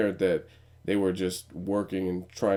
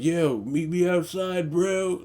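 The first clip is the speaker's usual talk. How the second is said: tough guy; 9 semitones higher, slower than usual. Pretend. Imagined guy calling him out.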